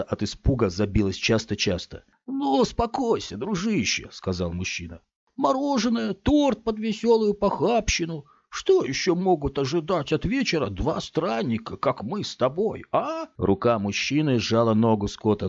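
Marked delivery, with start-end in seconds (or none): 2.3-4.1 soothing big man. Acted. Man soothes frightened boy. 5.4-13.3 coaxing big man. Acted. Man keeps coaxing the boy.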